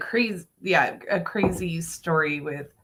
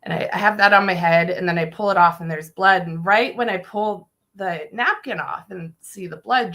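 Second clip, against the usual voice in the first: ordinary delivery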